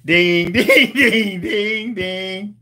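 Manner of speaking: ringing "ding" sounds in a row; repeated dings. Shown as a demonstration. Host acting out a sound for the co-host, chiming dings. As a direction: chime out a string of dings. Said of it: "come and just do ding".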